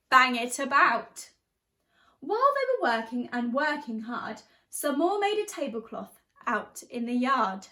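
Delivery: lively storybook reading in rhyme; teacher reading a picture book, animated; reading to children. A narrator's reading. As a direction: bounce the verses for a class. Reads picture book aloud.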